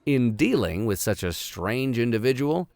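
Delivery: measured storybook reading voice; story narration. A narrator's reading. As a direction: read it like a classic adventure novel. Reads novel aloud as narrator.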